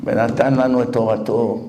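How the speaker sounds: slow chanted blessing; blessing recited. Real, not acted. Preacher quoting the blessing, slow. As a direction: intone it slow like in prayer. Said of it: "זה מה שאנחנו אומרים".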